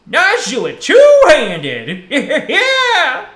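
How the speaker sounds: high, slow, laughing geezer shout; funny old man voice. Acted. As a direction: ham it up like a cackling old wizard. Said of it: "funny old man voice".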